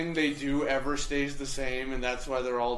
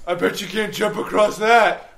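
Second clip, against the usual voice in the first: loud teasing dare; mocking taunt; 4 semitones higher, 7 dB louder than usual. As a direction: taunt the player like a bully.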